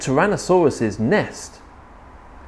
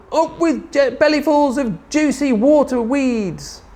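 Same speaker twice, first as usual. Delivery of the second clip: high delighted hooting cheer; 11 semitones higher than usual. A narrator's reading. Duckbills cheering their water weeds, high hoot. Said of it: "And they hooted".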